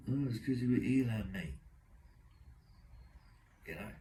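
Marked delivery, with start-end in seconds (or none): 0.1-1.5 lightly imitated whiner, pitched up; whiny little voice. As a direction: put on a slightly higher, whiny little voice. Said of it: "this whiny little voice go".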